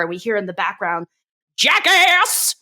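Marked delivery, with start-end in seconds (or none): 1.6-2.5 a higher, louder mock shout from the back; "Jackass!" called out higher and louder; retold heckle yell. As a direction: heckle it like a drunk from the crowd. Heckler yells from the crowd.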